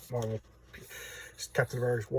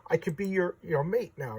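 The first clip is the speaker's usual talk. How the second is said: high, eager mock pleading; imitated needy boasting, pitched up; begging for attention; 6 semitones higher than usual. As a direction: show off desperately to win someone over. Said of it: "begging her for attention".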